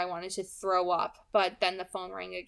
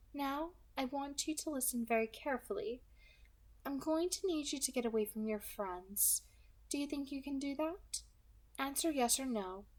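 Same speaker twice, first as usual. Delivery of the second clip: light, higher, smooth villain voice; pleasant soft alto; 6 semitones higher, 7 dB quieter than usual. Acted. Kidnapper calmly threatening the girl on the phone, pleasant and light. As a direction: menace wrapped in a pleasant voice.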